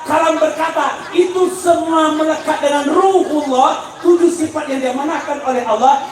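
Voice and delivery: high, driving preacher's delivery; pressing sermon, pitched high; forceful preaching. Real, not acted.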